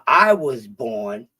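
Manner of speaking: ordinary talk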